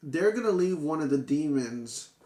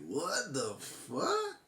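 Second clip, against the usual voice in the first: soft, slow, drawn-out disbelieving whisper of a curse; hushed, slowed-down shock; stunned disbelief; 7 dB quieter, much slower than usual. Retold or imitated. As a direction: let it fall out of you, quiet and stunned. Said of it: "I was like".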